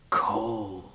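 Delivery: soft, trailing single word; hushed echo. Acted. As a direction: let the word fade away quietly. Poem echoes the child's word.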